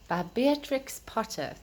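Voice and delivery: plain reading voice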